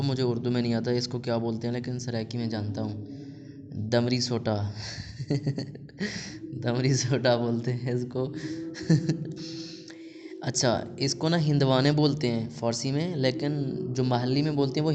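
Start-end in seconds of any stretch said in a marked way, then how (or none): 4.8-6.4 quiet breathy chuckle. Real, not acted. Amused by his Saraiki word. 8.4-10.4 soft, voiceless chuckling; chuckle. Real, not acted. Amused by his Saraiki word.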